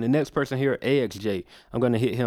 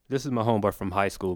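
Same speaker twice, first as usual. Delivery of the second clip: normal talking voice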